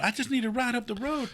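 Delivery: high-pitched imitation of a woman; pleading lady. Imitating the lady asking for a ride, pleading.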